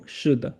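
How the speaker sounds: "是的" dicho claro, despacio, para que el alumno lo repita; pronunciación modelo para repetir. Shown as a demonstration. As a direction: modela "是的" con tono marcado. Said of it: "Pues repite conmigo". (profesora modela "是的" para que el alumno repita)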